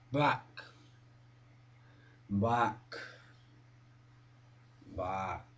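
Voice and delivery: low, soft, sinking further each word; fading incantation. Acted. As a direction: let each word drift down and away. Drifting back into memory.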